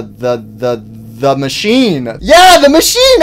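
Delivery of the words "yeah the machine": high, loud sudden cheer; excited outburst. Real, not acted. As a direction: blurt it out like you just remembered. Sudden burst of recognition.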